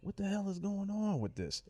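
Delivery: high, softened incredulous squeak; acted-out quiet, high disbelief; mock bewilderment. Acts out reaction to friend's news.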